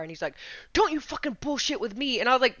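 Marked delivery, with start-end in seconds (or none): 0.7-2.2 higher-pitched retold angry warning; mock angry order, no real shout; mad cop. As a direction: imitate an officer losing patience. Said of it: "he gets mad at me".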